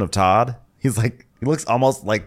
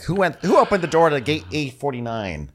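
mock airport voice; 7 semitones higher than usual. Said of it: "it's like".

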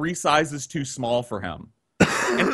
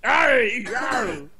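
Muppet voice; 7 semitones higher, much slower than usual. Real, not acted. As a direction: blurt it like a Muppet. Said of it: "You turned into a Muppet".

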